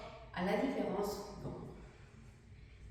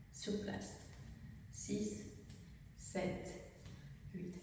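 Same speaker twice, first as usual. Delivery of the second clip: soft, spaced-out counting in time; dance count; 8 dB quieter, much slower than usual. Shown as a demonstration. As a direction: count the steps quietly, one beat at a time. Counts dance steps aloud. (compte de danse, doux et rythmé)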